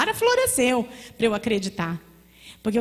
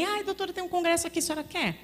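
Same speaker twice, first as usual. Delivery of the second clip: higher, softer sweet-talking voice; fawning rep; 8 semitones higher, 4 dB quieter, faster than usual. Retold or imitated. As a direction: coax her like a flattering salesman. Sales reps flatter the doctor.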